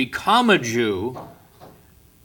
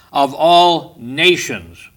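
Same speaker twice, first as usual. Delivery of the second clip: "of all nations" drawn out slowly, every word weighted and louder; slow down and press hard on "all nations"; stress on nations; 5 dB louder, much slower than usual. Shown as a demonstration. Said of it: "let me, uh, emphasize the word nations".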